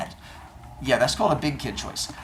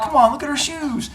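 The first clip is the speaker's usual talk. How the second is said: put-on pleading kid; 12 semitones higher than usual. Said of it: "And he's like".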